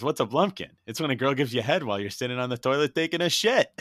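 loud, high acted-out exchange; animated retelling. Podcaster acts out a crude movie punchline, loud and gleeful. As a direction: rattle out the explanation high and loud.

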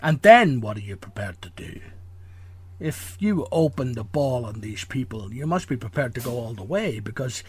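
a hint of Connery's Scottish menace; Sean Connery impression. Host plays Malone to guest's Ness: lightly voiced Connery. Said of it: "have a Sean Connery accent".

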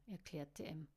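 ordinary delivery

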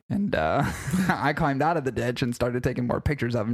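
ordinary talk

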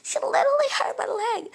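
high, loud whimpering fake sob; fake crying. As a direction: whine it out with fake tears. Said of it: "that's how she was doing it".

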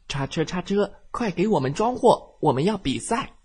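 high, loud eager call. Acted. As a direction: call for the forklift to hurry. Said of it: "一边高声喊道". (高声呼喊)

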